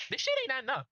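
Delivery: high-pitched voice. Real, not acted. Video maker doubting the story to viewers, high-pitched voice. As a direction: voice jumping up high. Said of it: "That high-pitched version of his voice".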